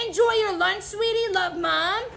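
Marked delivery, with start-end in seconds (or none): none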